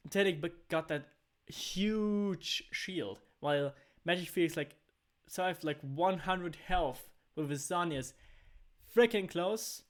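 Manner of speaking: loud, high esports casting; play-by-play. Acted. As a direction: call the teamfight live. Live play-by-play continues.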